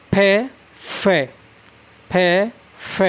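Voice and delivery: फ contrasted as ph and f; फ said first as ph then as f; फ with ph, then with an f sound; nukta pronunciation. Shown as a demonstration. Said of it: "नुक्ता के साथ इसका उच्चारण करूँ".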